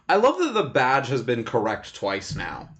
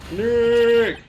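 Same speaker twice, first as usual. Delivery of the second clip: loud drawn-out call; shouted name; 9 semitones higher, 7 dB louder than usual. Acted. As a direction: yell it at the mic. Shouting at the camera.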